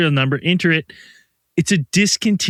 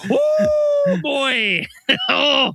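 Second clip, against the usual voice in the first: exasperated cry; 10 semitones higher, much slower than usual. Real, not acted. Venting about the dead thermostat.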